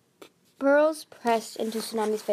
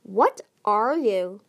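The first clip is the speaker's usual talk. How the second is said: slow, loud, drawn-out question; imperious demand; 6 dB louder, much slower than usual. A narrator's reading. Queen Coral confronting Sunny, imperious and slow. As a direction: demand an answer with disdain.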